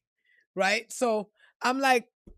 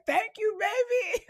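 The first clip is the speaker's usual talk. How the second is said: gushing thanks; 10 semitones higher than usual. Retold or imitated. She accepts the praise.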